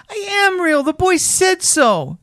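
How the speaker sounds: very high, desperate little rabbit; upset high-pitched insistence; near tears. A narrator's reading. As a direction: protest in a high voice, on the edge of crying. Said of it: "he nearly began to cry".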